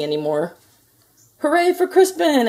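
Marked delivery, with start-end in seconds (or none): 1.4-2.5 loud, high joyful cheer; a crowd cheering for Crispin; cheering shout. A narrator's reading. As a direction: shout it out in triumph. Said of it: "everybody shouted".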